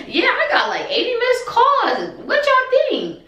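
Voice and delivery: a laughing mom brushing off the fuss; amused mom. Retold or imitated.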